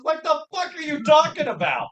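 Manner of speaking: high-pitched mock bafflement; retold disbelief. Co-host reacting to random Russian accent, mock baffled. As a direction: ask in high, exaggerated disbelief.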